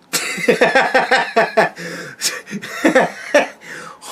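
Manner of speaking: a high, unvoiced laugh; breathy laugh. Real, not acted. Laughing at his own rant about [name] fans in a high unvoiced laugh. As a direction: laugh it off under your breath.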